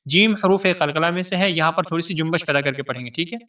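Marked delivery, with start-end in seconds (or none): none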